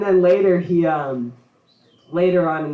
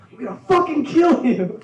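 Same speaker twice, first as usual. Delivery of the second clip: high, loud laughing mock rage; furious yell; 9 semitones higher, 5 dB louder than usual. Kid hearing Beethoven on the radio, mock rage. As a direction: explode like a furious eight-year-old. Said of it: "you're just like".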